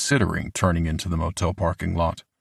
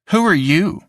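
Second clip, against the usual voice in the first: put-on high, loud kid's challenge; bratty kid demand; 11 semitones higher, 5 dB louder than usual. A narrator's reading. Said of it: "one of the skinny kids demanded".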